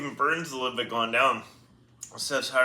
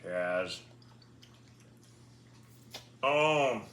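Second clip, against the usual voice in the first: ordinary talk, nothing marked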